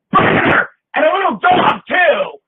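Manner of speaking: loud, high scream, slow on the last words. Retold or imitated. Extra screaming at the witch burning to stand out, loud and high. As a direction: scream it to wake up the crowd. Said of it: "I screamed".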